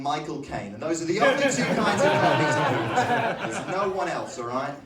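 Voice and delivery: emphatic Cockney patter; Cockney accent. Shown as a demonstration. Comic listing the rules of Cockneys, in a Cockney accent. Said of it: "let's go Cockney English accent".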